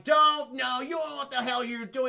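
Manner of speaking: blustering acted comeback; indignant retort. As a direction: protest hotly, hammed up. Acted indignant reply in a film retelling.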